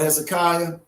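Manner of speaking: Hezekiah as ez-eh-KYE-uh; common pronunciation. Shown as a demonstration. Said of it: "commonly called".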